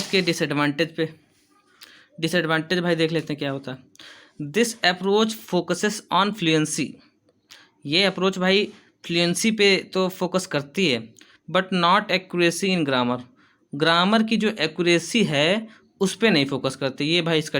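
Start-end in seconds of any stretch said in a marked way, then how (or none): 4.5-6.9 slow, careful dictation pace, said twice; teacher dictating notes. Shown as a demonstration. Teacher dictating an English point. 11.5-13.2 slow, careful dictation pace, said twice; teacher dictating notes. Shown as a demonstration. Teacher dictating an English point.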